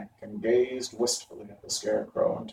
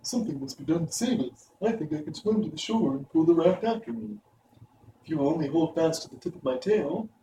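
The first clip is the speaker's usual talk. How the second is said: higher lion voice, steady and bold; 5 semitones higher than usual. A narrator's reading. Lion to friends on the raft, a bold plan. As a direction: play the Lion with a lifted voice. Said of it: "Then the Lion said".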